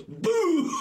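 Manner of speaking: sudden very high whoosh-boom noise; high-pitched mimicked blast; imitated explosive strike. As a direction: burst out with the sound of a fish hitting.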